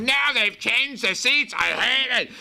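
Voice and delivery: put-on high, whiny complaint of a bitter old lady; cranky old woman. Cranky woman on the plane, high and whining about the seats. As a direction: grumble like a miserable old passenger. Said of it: "cantankerous and miserable".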